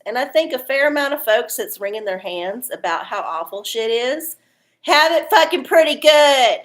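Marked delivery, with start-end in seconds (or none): 4.8-6.6 louder, higher, punch the fucking; exasperated emphatic rant; emphatic punchline. Real, not acted. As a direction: drive the point home hard. Ranting about complainers.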